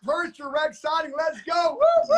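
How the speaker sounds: high hype building to whoops; excited shout breaking into high woos; cheering. Real, not acted. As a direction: celebrate like the winning point just landed. Host cheers the guest's win.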